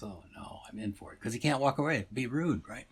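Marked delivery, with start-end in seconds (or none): 0.0-1.2 much softer, private thought; internal dismay. Acted. Trapped shopper thinking to himself, very soft dismay. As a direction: drop the volume right down, dreading it. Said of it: "The guy goes".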